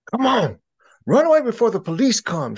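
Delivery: high, loud frantic urging; panicked urging. Acted. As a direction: scramble away in a panic. Gator urges flight.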